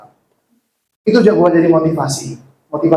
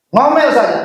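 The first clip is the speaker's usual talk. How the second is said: emphatic urging; 8 semitones higher than usual. Real, not acted. Pastor urging the congregation, emphatic call. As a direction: throw the permission out boldly.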